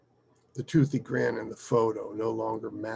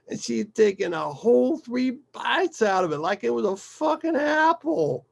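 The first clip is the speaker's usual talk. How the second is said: higher-pitched, mounting disbelief; animated father storyteller; 6 semitones higher, 4 dB louder than usual. Acted. Father recounting his daughter's lemon tantrum, incredulous. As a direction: build to the absurd punchline. Mike's animated retelling continues.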